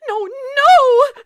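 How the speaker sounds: high, loud panicked cry. Acted. Frantic wife begging the operator in panic. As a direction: cry out in alarm.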